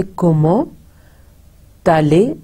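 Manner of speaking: "Comment ... allez" with the silent T carried over as "talle"; liaison demo. Shown as a demonstration. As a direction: pause after "Comment", then join a T onto "allez". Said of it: "is pronounced like te".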